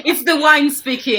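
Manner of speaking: said through laughter; laughing. Real, not acted. Guest joking about her French lesson, laughing. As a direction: joke through the giggles.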